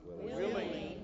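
the congregation murmurs willing; answered softly from the room; distant crowd answer. Real, not acted. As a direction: congregation reply, off mic.

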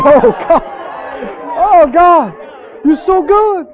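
high, loud cry easing as it repeats; reliving ecstatic shouts to God; overjoyed praise. Retold or imitated. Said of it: "Got so happy".

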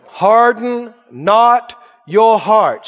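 slow, loud, every word weighted; slow emphatic recitation. Shown as a demonstration. Preacher leading the verse: slow, loud, drawn out. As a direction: lead the church through the verse slowly. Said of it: "Say that out loud".

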